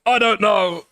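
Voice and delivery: high, loud outburst; exasperated indecision. Real, not acted. Streamer torn over changing her opinion, loud high outburst.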